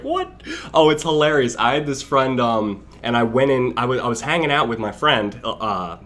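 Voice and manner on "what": high, rising, puzzled what; a teacher spotting a stranger in the exam; teacher's baffled what. Retold or imitated. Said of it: "And the teacher's like".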